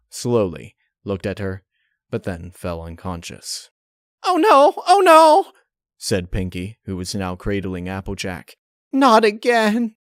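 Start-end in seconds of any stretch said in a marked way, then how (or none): 4.2-5.5 panicked cry. A narrator's reading. Pinkie panics over Applejack. 8.9-10.0 despairing wail. A narrator's reading. Pinkie despairs.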